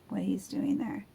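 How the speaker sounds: ordinary delivery, nothing marked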